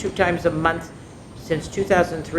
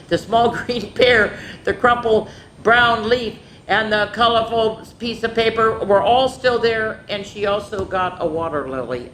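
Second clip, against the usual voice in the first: usual speaking voice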